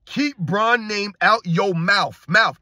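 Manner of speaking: slow quote, "mouth" ending in an F sound; mocking "mouf". Host mocking a fan's misspelled message, "mouth" said as "mouf". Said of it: "That's how you spell mouth these days".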